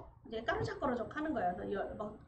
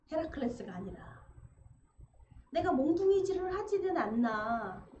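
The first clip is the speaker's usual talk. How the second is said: ordinary talk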